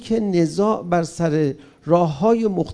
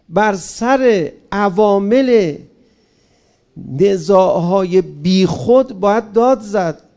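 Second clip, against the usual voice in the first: very slow and loud, بر سر عوامل drawn out, داد punched; emphatic slow declaration; 6 dB louder, slower than usual. Real, not acted. Restating to the crowd when shouting is right, slow and loud. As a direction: drive the point home word by word.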